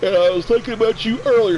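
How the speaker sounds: yawning mid-sentence; said through a yawn. Real, not acted. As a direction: talk right through the yawn. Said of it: "Excuse me for yawning".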